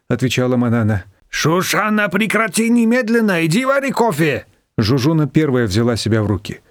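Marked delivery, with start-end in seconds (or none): 1.3-4.4 high-pitched, firm scolding; sharp command. A narrator's reading. Zhuzhuna taking charge of flustered Shushana, sharply commanding. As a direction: order her to stop at once.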